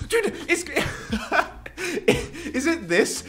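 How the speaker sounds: high, giggling question, stretched slowly; laughing disbelief. Real, not acted. Laughing at glitching screen.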